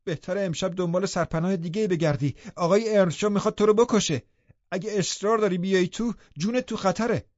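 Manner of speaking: high, urgent call through a window; shouted warning. A narrator's reading. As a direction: shout a warning to someone outside, pitch lifted. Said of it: "خیلی بلند داد زدم".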